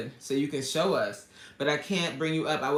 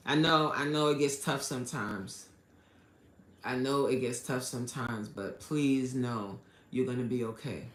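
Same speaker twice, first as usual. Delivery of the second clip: softer, lower, real comforting tone; gentle reassurance; 4 semitones lower, 3 dB quieter than usual. Real, not acted. Host comforting a viewer when it gets tough, reassuring. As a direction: console a friend, leaving room between phrases.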